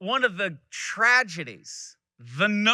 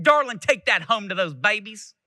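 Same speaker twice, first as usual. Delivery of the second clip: demanding Southern woman; 5 semitones higher, 5 dB louder than usual. Retold or imitated. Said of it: "kind of the demanding Southern woman".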